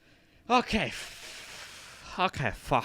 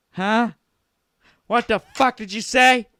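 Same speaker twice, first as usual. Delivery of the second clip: high, loud mock challenge; high loud mock threat; mock aggressive; 8 semitones higher, 10 dB louder than usual. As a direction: get in their face, hammed-up. Mock confrontation.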